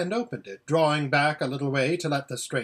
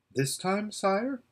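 lightly voiced girl, higher questioning tone; timid girl; 6 semitones higher, 3 dB quieter, slower than usual. Aravis questions the lion, timid. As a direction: ask timidly, pitched up.

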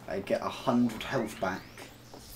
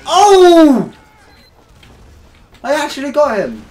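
excited shriek; 19 dB louder, slower than usual. Real, not acted. Surprise kill in game.

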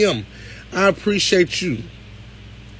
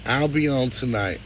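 ordinary talk, nothing marked

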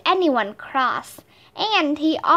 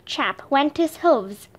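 plain reading voice

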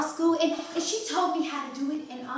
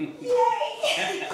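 delighted laughing yay; 9 semitones higher than usual. Acted. Girl delighted at long holiday.

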